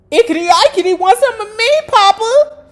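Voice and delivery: very high, loud sing-song mockery; hammed-up girlish taunt; mocking high voice.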